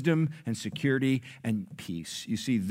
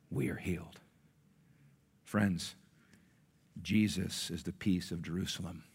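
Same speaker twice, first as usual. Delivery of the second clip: low, soft, reverent delivery with long pauses; solemn preaching; 3 semitones lower, 5 dB quieter than usual. Acted. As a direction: let it settle gently on the congregation. Solemn close of sermon.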